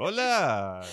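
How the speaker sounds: a bright caricature of an Israeli hello; Israeli accent. Retold or imitated. Showing friends how Israelis speak Spanish, mock Israeli accent. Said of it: "así hablan los israelíes con acento en español".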